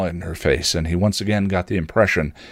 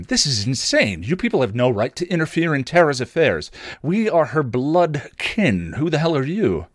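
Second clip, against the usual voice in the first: higher, indignant father's rant; 7 semitones higher than usual. Acted. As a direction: rail at them like an outraged father. Father ranting at the group.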